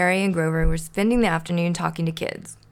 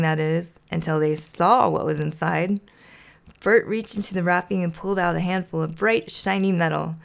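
usual speaking voice

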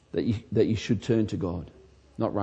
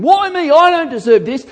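loud, high outraged cry; angry complaint; 11 dB louder than usual. Retold or imitated. Sufferer shouting angrily at God. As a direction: wail it at God in indignation. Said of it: "you get angry and start saying".

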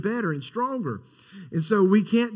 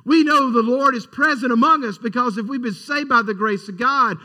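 rising, louder, fervent sermon voice; preaching at full force; 3 semitones higher, 3 dB louder than usual. Acted. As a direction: preach it with growing intensity to the congregation.

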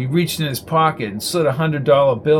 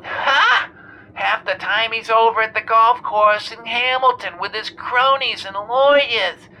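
put-on much higher, griping old lady; irate caller; 9 semitones higher than usual. A narrator's reading. Jenny calling the radio show, irate. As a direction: complain shrilly down the line.

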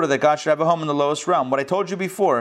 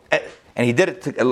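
normal talking voice

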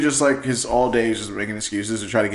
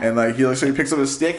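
ordinary talk

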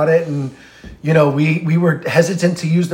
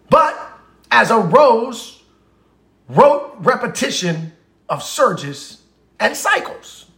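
slow, measured poetic reading with higher pitch; poetry recitation; 5 semitones higher, slower than usual. Acted. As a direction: recite it slowly, lifting the pitch, pausing between phrases. Recites a poem aloud.

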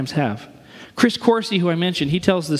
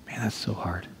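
much softer, choked whispered sympathy; tearful whisper; 6 semitones lower, 11 dB quieter than usual. Retold or imitated. Corsi comforting the man with cancer, tearful whisper. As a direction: whisper through tears to the sick man. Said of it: "Corsi starts crying with him".

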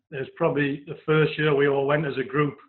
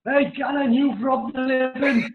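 higher, slow, drawn-out teasing shout, laughing at the end; mock heckle; 9 semitones higher, much slower than usual. [name] heckling arriving player.

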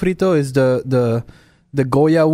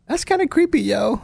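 incredulous retort; 9 semitones higher than usual. Retold or imitated. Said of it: "I'm like".

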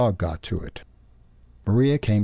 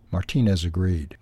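ordinary delivery, nothing marked

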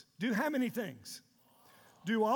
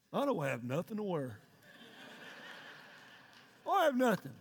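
mock whiny complaint; 6 semitones lower than usual. Mocking wife's closet complaint.

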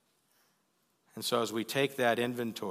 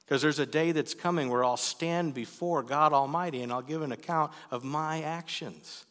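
usual speaking voice

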